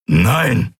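a growling, stubborn no; growled refusal. A narrator's reading. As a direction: growl it out with a rise and fall. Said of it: "knurrte er".

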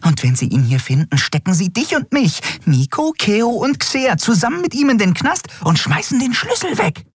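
high, tiny fairy voice, upset; a little winged friend scolding in alarm; agitated fairy. A narrator's reading. (aufgeregte kleine Fee, hoch)

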